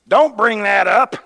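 loud, high, urgent protest; alarmed outburst. Acted. Preacher voicing what Peter shouldn't say to hot leaders, loud protest. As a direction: burst out as if to shut him up. Acted reaction of angered hearers.

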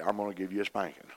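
toned-down dad. Retold or imitated. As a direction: play the dad but gently. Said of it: "I ain't gonna say it like my dad said".

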